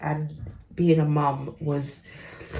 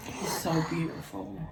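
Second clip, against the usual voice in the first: whisper; 9 dB quieter than usual. Real, not acted. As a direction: breathe the words out softly. Whispering about baby.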